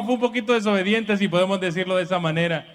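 ordinary talk, nothing marked

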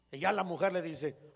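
usual speaking voice

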